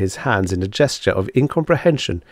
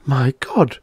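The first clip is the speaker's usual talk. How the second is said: higher, mildly shocked exclamation; gasped shock; 6 semitones higher than usual. A narrator's reading. Patient hears she has months to live, mildly aghast. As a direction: take in the news with a slight gasp. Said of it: "Miss Twilly gasped".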